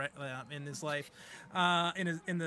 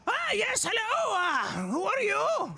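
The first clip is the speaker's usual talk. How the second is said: put-on high, creaky old goblin greeting; elderly goblin; 5 dB louder, slower than usual. Old goblin at the ticket booth greets the party, high and doddering. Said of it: "to this ticket booth and goes".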